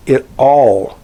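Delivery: all leaned on and held; "all" said slowly with emphasis; stress on ALL. Shown as a demonstration. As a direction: put weight on the word "all". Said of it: "I'm stressing the word all".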